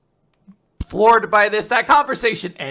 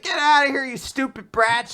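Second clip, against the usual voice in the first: mock yell at bullies; 8 semitones higher than usual. Bonnie telling off the bullies, higher mock yell. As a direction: shout the bullies off like a tough kid.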